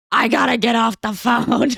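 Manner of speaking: mock fed-up outburst. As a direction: burst out like an overwhelmed husband, joking. Husband fed up, acted out.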